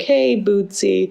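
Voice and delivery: slow, soft call to a pet; gentle coaxing. Retold or imitated. Walking towards the runaway cat, gently coaxing. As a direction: sweet-talk the cat, drawn out. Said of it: "You walk towards him and you're like".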